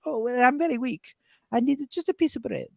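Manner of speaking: high, feeble nonna voice; frail Italian grandmother. Retold or imitated. Grandmother insisting she needs bread, in high frail voice. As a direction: whimper weakly in an Italian granny accent. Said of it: "She's like".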